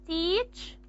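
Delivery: teacher broken into "tea... cha"; syllable by syllable. Shown as a demonstration. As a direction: sound out teacher in two separate syllables. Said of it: "I'm going to transcribe the word teacher".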